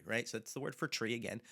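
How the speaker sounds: ordinary talk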